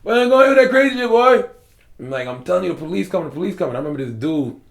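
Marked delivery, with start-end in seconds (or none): 0.0-1.4 put-on high, loud country-boy holler. Friend hollering about him in country talk, loud and high. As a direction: belt it out like a South Carolina country boy. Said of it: "A lot of our people talk like that".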